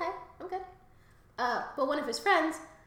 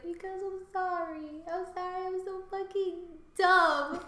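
tearful; 8 semitones higher than usual. Retold or imitated. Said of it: "And I was like".

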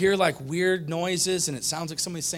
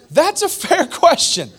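emphatic; 13 semitones higher, 10 dB louder, slower than usual. Real, not acted.

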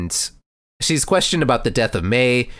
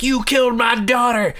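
furious shout; 13 semitones higher than usual. Retold or imitated. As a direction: scream it at her in fury. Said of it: "the uncle comes in and is like".

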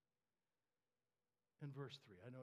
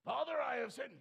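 loud high plea, much louder; pleading son; 9 semitones higher, 14 dB louder than usual. Acted. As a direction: cry out the confession high and loud. Son's plea acted again.

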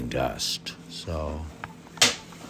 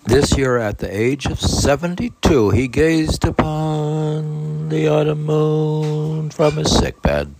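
solemn reading aloud; 12 semitones higher, 9 dB louder than usual. Acted. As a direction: lift the voice and read it solemnly. Reads the biography aloud.

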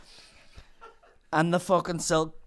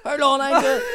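much louder and higher "Hold on"; panicked call; 12 semitones higher, 10 dB louder than usual. Retold or imitated. Apprentice stopping the customer going upstairs: panicked loud call.

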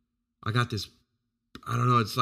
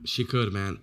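ordinary talk

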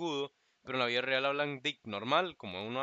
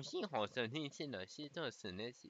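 soft sing-song imitation of Mandarin tones; mock Mandarin; 9 dB quieter, slower than usual. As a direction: mimic tonal Mandarin chatter, quietly. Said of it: "usted ve que habla como".

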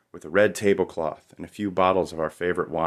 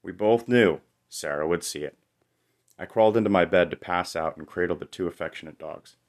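ordinary delivery

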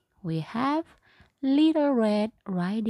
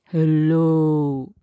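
low, loud wolf greeting; a big bad wolf saying hello; 6 semitones lower, 7 dB louder than usual. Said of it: "said the wolf".

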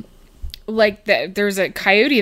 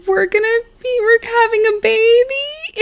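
acted tearful quaver, pitched way up; teary high voice. Retelling Charlotte's baby news, in a high teary quaver. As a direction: play Charlotte choking up with happy tears.

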